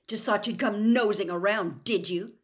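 loud accusing shout; angry sneering yell. A narrator's reading. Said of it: "Harry shouted".

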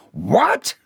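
high, sharp, rising exclamation; outraged shock. A narrator's reading. Boss hearing of John's affair, outraged. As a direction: burst out in appalled disbelief.

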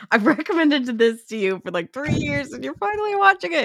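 teasing a friend, rising in playful triumph; mock gloating excitement. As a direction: crow it like you've finally won. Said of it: "I'm like".

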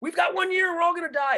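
high panicked mockery; mock alarmist. Mocking climate doom hyperbole, high and panicked. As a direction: ham up the doomsayer panic. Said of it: "little bit over hyperbole".

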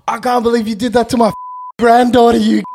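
high, loud fast shout of an outraged granddad; imitated furious shout. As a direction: yell it over the glass. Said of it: "Then he goes".

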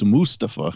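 Mustafa as MOOS-tuh-fuh; front-stressed Mustafa with a short middle vowel; correct pronunciation. Shown as a demonstration. Passing on the wrestler's correct way, Mustafa stressed on the first syllable. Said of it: "the correct way to say it's".

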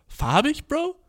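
"Farbig" rising into a very high "Bro"; mock disbelief. To the boy again, high incredulous echo. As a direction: throw the word back in disbelief.

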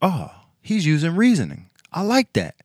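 narrator's impressed thought while watching; mock impressed. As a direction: chirp it like you're pleasantly surprised. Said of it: "I was like".

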